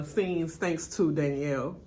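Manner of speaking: normal talking voice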